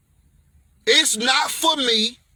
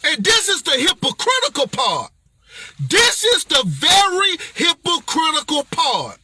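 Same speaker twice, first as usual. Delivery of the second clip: fiery preaching; 5 semitones higher than usual. Acted. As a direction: preach it hard at the crowd. Preacher builds to full force.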